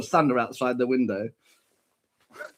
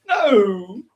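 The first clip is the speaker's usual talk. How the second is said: mock cry; 6 semitones higher, 5 dB louder than usual. Son cries out.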